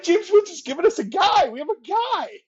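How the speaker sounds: high, loud mock-giddy exclamation; retold giddy outburst to a friend; amazed excitement. Acting out amazed reaction to friend.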